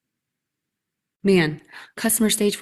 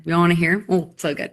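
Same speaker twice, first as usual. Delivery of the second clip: ordinary talk, nothing marked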